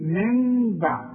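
من بعد, slow, no lip closing on ن; slow open lips. Shown as a demonstration. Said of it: "ما اقفلش شفايفي". (بدون إقفال الشفتين)

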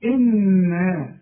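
إنا slowly with a held nun; nasal ghunna. Shown as a demonstration. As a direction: hum the doubled nun in إنا. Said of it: "لازم تغن". (إنا بغنة طويلة)

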